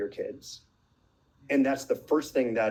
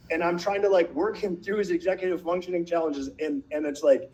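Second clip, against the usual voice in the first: usual speaking voice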